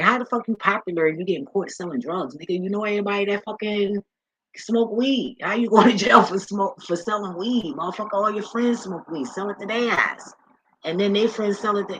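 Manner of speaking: high-pitched, incredulous telling-off; incredulous scolding. Retold or imitated. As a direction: scold them like you can't believe it. Acting out her scolding of dealers.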